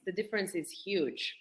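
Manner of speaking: normal talking voice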